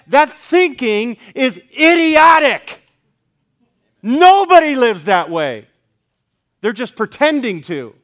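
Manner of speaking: preacher denouncing relativism to the congregation; full-force preaching. Acted. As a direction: pound the point home.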